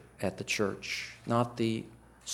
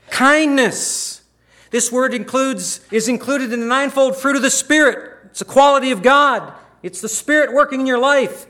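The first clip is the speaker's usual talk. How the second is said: full-force preaching; 13 semitones higher, 12 dB louder than usual. Acted. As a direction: preach it out loud and high, in full fervour. Preaching on kindness.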